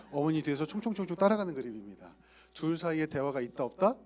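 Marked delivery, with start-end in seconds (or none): none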